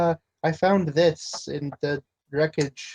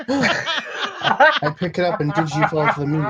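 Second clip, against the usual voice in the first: laughing; 4 semitones higher, 6 dB louder than usual. Real, not acted. Player gasps and laughs.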